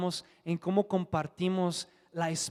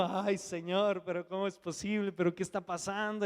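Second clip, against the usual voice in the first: llanto contado; 2 semitones higher than usual. Retold or imitated. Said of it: "Me puse a llorar".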